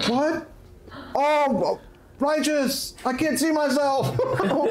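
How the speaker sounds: high, thrilled surfer-dude wonder; hammed-up delight with a laugh; happy. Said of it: "it sounds like he's happy".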